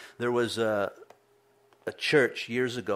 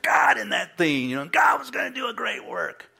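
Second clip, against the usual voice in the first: mock preacher shout; 4 semitones higher, 5 dB louder than usual. Mocking a preacher's excited claim.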